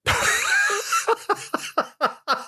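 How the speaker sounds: a high shrieking laugh that breaks out. Real, not acted. Host reading his old forum post bursts into a shrieking laugh.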